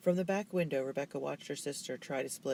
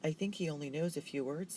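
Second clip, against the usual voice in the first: ordinary delivery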